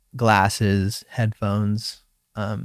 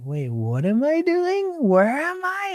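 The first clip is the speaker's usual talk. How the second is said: much higher, slow, bewildered; confused disbelief. Retold or imitated. Surfer recalling losing track, high bewildered questions. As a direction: ask it lost and floating up high. Retold disorientation, paddling wrong way.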